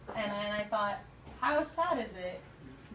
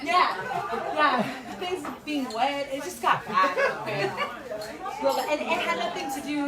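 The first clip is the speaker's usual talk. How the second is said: laughing; 6 semitones higher, 8 dB louder, slower than usual. Real, not acted. Laughing about the inappropriate poem.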